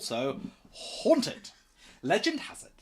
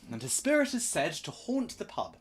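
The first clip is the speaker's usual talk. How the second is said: ordinary delivery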